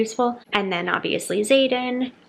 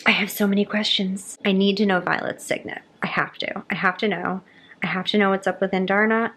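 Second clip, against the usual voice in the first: normal talking voice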